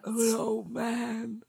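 put-on slow wheezing old man; wheezy voice. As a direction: wheeze out the words. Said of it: "It just sounds like you're wheezing".

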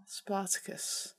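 slow, hushed whisper; drawn-out stage whisper. Acted. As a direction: breathe the name out slowly as a whisper. Whispers the name after a pause.

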